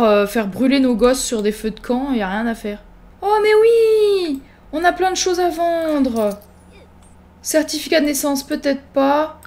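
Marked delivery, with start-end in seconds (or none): 3.2-6.3 high, drawn-out mock joy; exaggerated delight. Player remembers things to sell, exaggerated delight. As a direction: squeal it slowly with glee. Sudden idea, drawn-out cry.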